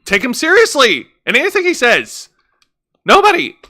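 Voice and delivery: high, incredulous rant; exasperated outburst. Real, not acted. Host rants that the commentator has no credibility, high and exasperated. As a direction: let the voice leap up in frustration.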